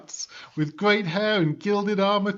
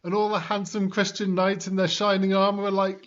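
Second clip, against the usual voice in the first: ordinary talk, nothing marked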